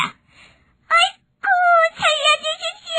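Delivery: very high, slow mouse wail; thin pleading voice. Acted. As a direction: squeal for mercy as a pinned mouse. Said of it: "가냘픈 목소리로 애원했습니다". (가냘픈 목소리로 애원하는 생쥐)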